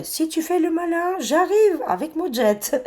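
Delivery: put-on high, clumsy American accent in French; bad American accent. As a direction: ham up an American threat with a mock accent. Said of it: "Je le fais très mal, l'accent américain". (accent américain mal imité, aigu)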